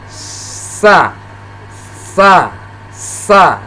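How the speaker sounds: SA with a long hissed s from the tongue tip; tongue-tip sin. Shown as a demonstration. Teacher demonstrating the letter sin, tongue-tip hissed sa. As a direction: hold a thin s, then open into sa. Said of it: "huruf sin itu tempat keluarnya".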